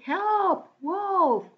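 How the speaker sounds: high mock call of "help, wolf"; boy crying for help. Boy in story cries wolf.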